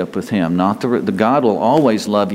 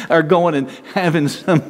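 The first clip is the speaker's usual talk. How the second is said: words through a laugh; 4 semitones higher than usual. Real, not acted. Pastor joking to the congregation, still laughing. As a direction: keep giggling as you speak.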